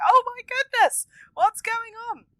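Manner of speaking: high, loud, hammed-up alarm; mock alarm. Viewer reacting to surgery news, loud mock alarm. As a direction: gasp it out like a shocked viewer. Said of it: "And you're like".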